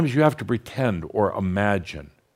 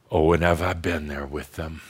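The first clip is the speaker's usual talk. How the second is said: ordinary talk, nothing marked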